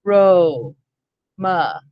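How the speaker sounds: Ro and ma split with a clear break; syllables said apart. Shown as a demonstration. Teacher to class, splitting aroma into syllables. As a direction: say "Ro" then "ma" as two separate beats. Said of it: "First syllable has one sound".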